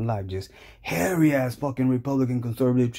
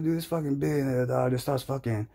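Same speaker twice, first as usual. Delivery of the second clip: ordinary talk, nothing marked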